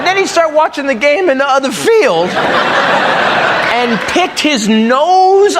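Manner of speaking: put-on lazy, drifting kid voice; dazed kid. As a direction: play the dreamy nose-picker. Acting out dazed kid picking nose.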